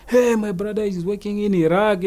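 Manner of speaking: high, whining-style mock excuse; imitated suspect's excuse. Acts out suspect making excuses.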